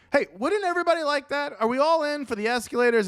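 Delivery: high, fast, eager pitch to the crowd; eager rallying call. Acted. Villager rallying neighbours for escalators, high and eager.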